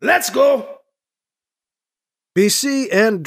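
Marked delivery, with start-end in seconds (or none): 0.0-0.8 high, raised commander's shout; urgent barked orders. Acted. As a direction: call out an evacuation to the troops. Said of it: "Erdru shouts".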